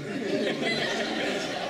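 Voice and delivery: high, loud, uncontrolled laughter; real big belly laugh; super loud laugh. Real, not acted. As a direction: burst out laughing at full volume. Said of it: "I laugh super loud".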